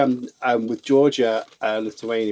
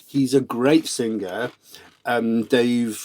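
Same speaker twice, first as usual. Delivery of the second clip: ordinary talk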